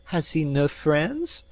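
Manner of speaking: high, slow, pitying voice; tearful. A narrator's reading. Maid pities the sick lad at the table, tearful. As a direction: ask it slowly and high, close to tears. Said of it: "in a tearful voice".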